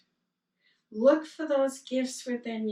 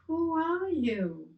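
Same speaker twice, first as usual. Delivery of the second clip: echo; 7 semitones higher, slower than usual. Retold or imitated. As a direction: let it come back like a distant echo. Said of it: "And he heard".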